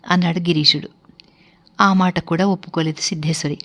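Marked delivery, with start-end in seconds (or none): none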